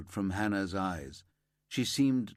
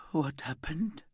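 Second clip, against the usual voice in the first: whisper; 7 semitones higher than usual. Acted. Grieving mother asks, exhausted.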